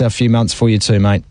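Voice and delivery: usual speaking voice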